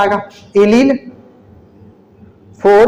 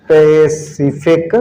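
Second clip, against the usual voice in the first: very slow, lower, syllable by syllable; slow dictation; 5 semitones lower, much slower than usual. Real, not acted. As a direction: break the word up for note-takers. Teacher spelling out by syllables. (धीमे, अक्षर-अक्षर लिखवाते हुए)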